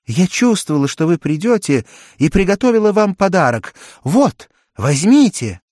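put-on high, loud eager girl; young girl voice. A narrator's reading. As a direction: offer the gift eagerly. Джен дарит подарок. (звонкий девичий голос)

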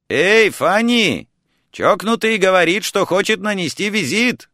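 high, carrying holler; calling upstairs. A narrator's reading. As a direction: shout up to the floor above. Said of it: "крикнуть второму этажу".

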